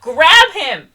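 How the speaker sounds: loud, high yell at the screen; yelling at a useless character; exasperated shout. Real, not acted. Exasperated at the movie's mother.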